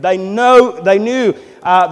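ordinary talk

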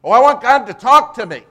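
very loud, high mock plea; mock pleading. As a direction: cry out high, imitating an over-eager believer. Preacher mimics believers wanting God.

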